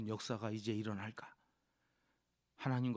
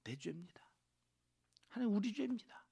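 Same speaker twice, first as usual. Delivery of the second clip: humble prayer; 8 semitones higher, faster than usual. Retold or imitated. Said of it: "그러면서 ... 기도해요".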